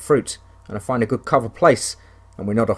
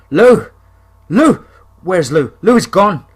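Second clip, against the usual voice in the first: high, loud frantic calls; frantic calling; 7 semitones higher, 7 dB louder, slower than usual. Acted. Hunter searching for his missing dog, frantic shouting. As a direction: call out in rising panic.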